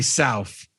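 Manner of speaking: South ending in f, "Souf"; joking f sound closing South. Pretend. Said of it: "with an F".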